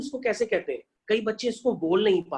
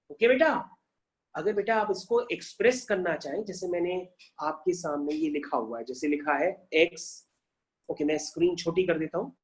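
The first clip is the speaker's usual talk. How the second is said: ordinary talk, nothing marked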